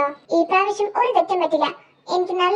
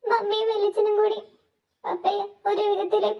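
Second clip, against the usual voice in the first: higher, softer halting delivery; tearful; 4 semitones higher, 5 dB quieter than usual. Acted. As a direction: finish the bad news in an acted sob-voice. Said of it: "നീ എന്തിനാ കരയുന്നേ".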